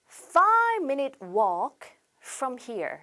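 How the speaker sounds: very slow, word-by-word English phrase; model reading. Shown as a demonstration. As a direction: draw it out slowly for learners to copy. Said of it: "이렇게 발음을 하셔야 되고".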